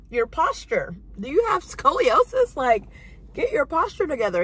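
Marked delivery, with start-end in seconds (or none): 1.2-2.5 higher, louder incredulous jab; mocking disbelief. Mocking her date's posture at the table, incredulous and louder. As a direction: needle him about his slouch. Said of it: "I'm like".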